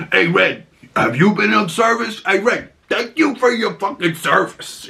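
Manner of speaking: lightly hammed-up Punisher line; Punisher impression. Acting out Frank's jab at Red, mock tough-guy shout.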